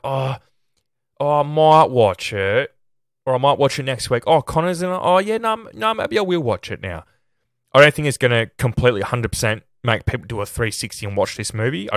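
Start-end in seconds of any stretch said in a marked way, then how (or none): 0.0-7.0 higher, dithering imitation of a viewer, slowing on the first line; mock hesitant moviegoer. Reviewer imitates a viewer deciding to watch, higher, mock-dithering. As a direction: play an undecided fan talking himself round. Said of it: "maybe they'll be like".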